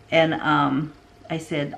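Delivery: ordinary talk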